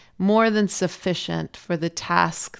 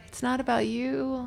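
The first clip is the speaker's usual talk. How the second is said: soft, higher, gentle self-reminder; hushed realisation; 6 semitones higher, 8 dB quieter than usual. Retold or imitated. Mother watching father and son, hushed realisation. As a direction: tell yourself softly, humbled.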